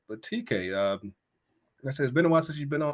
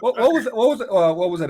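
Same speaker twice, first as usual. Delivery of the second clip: excited recall; 11 semitones higher, 9 dB louder, faster than usual. Real, not acted. Trying to recall a sketch.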